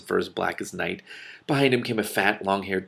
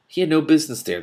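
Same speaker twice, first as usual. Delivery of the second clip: prim; 8 semitones higher, 6 dB louder than usual. A narrator's reading.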